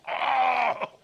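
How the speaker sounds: high, loud breaking cry; anguished cry. Acted. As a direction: let the word tear out of you.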